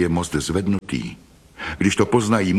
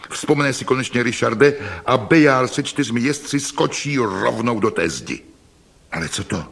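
ordinary delivery, nothing marked

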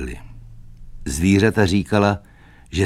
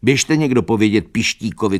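higher-pitched, pressing command; urgent order; 4 semitones higher, 4 dB louder than usual. A narrator's reading. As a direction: send someone running with the news. (naléhavý příkaz zvýšeným hlasem)

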